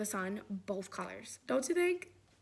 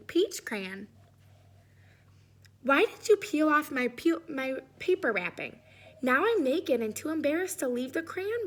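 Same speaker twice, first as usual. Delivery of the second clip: hammed-up shy crayon begging for clothes; mock embarrassed crayon; 3 semitones higher, 5 dB louder than usual. Reads Peach Crayon's letter.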